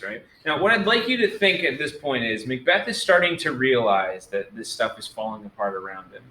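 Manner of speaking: usual speaking voice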